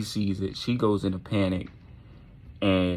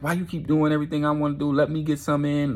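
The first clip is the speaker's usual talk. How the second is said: high, rushed, indignant complaint; mad protest; 7 semitones higher, faster than usual. Acted. Boy mad at girl taking over, mad high-pitched protest. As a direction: rattle it off high and aggrieved. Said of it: "Tucker gets very mad".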